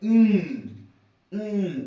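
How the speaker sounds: "మ్" as a nasal ng hum; ng sound demo. Shown as a demonstration. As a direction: hold "మ్" in the back of the throat like ng. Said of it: "అంటే ఎన్ కాదు". ("మ్" ను ఙ లాగా ముక్కుతో)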